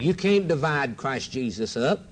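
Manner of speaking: ordinary talk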